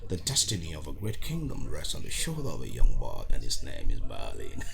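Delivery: deep, dramatic trailer narrator; mock epic trailer announcer; movie trailer voice. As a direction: boom it out like an epic film trailer. Dramatic movie-trailer line quoted.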